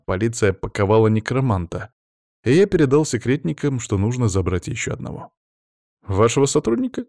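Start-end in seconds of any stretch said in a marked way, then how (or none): 6.0-7.1 high, rising puzzled question; taken aback, pitch lifting; surprised. A narrator's reading. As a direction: ask it in clear surprise. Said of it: "удивился Олег".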